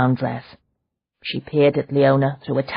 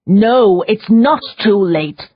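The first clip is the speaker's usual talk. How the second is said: fierce; 7 semitones higher, 7 dB louder, slower than usual. Acted. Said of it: "Leona insisted fiercely".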